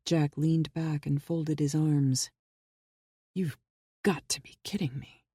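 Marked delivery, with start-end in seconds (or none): 3.3-5.2 stunned, barely voiced disbelief; incredulous whisper. A narrator's reading. Jack whispers his disbelief at the bomb story. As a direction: breathe it out in a hushed whisper.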